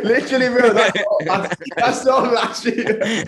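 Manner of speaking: laughing through the words; giggling as you talk. Real, not acted. Laughing at being old.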